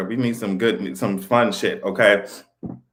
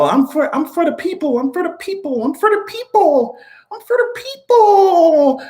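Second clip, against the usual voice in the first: high, drawn-out mock politician voice, pitched way up; hammed-up Macron, stretching each line higher; 13 semitones higher, slower than usual. Mocking Macron's speech to the people, high and slow. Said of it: "that's what Macron".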